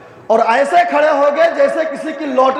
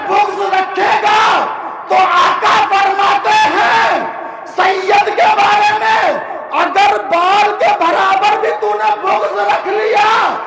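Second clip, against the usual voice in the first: fiery preaching; 6 semitones higher, slower than usual. Acted. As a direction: hammer each phrase home to the crowd. Preacher at full force on hadith. (جوشیلا خطاب، بلند اور دھیمی رفتار)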